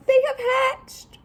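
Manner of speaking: high excited pig voice; high joyful piggy announcement. Acted. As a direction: squeak it like Piggy. Piggy voice again.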